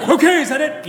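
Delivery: high, loud, laughing hurry-up; mock impatient priest who needs to pee. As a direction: rush the penitent along, laughing. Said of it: "he's like".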